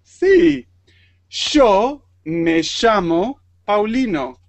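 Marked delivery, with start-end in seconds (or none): 1.3-4.4 "Yo me llamo" with y and ll said as sh, slowly; slow Rioplatense "Yo me llamo Paulino"; Argentine sh sound. Shown as a demonstration. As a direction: say "Yo" as sho and "llamo" as shamo. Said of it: "el sonido es sh".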